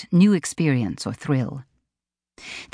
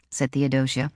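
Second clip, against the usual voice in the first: plain reading voice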